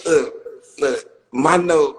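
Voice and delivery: ordinary talk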